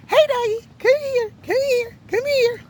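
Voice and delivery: high-pitched coaxing call to a pet; dog-calling voice. Retold or imitated. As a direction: imitate her sweet high voice for the dog. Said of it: "changing your voice".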